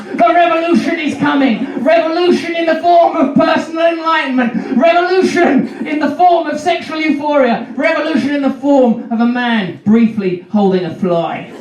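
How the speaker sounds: high, booming sermon shout; preaching Jesus. Acted. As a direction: preach like a messiah to crowds. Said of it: "you've got to accept I'm the new Jesus".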